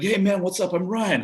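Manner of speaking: high, loud put-on American greeting; American accent. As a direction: greet like an over-friendly American. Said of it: "upset any of your American listeners".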